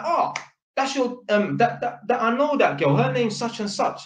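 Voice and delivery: light mock excitement at spotting a familiar face; excited recognition.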